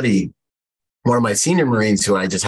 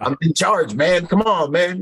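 exasperated plea; 9 semitones higher than usual. Retold or imitated. Pleading authority with buddy.

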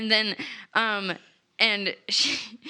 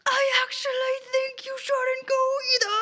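pitched-up Roscoe, half crying; high mock-teary voice; 12 semitones higher than usual. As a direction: squeak it out near tears. Roscoe voiced in retold scene.

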